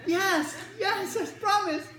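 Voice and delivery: put-on high, slow eager kid voice. Kid promising the doctor, high and eager. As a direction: promise it like an excited boy.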